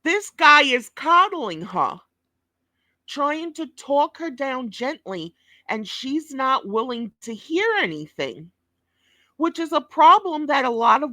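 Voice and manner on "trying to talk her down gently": ordinary talk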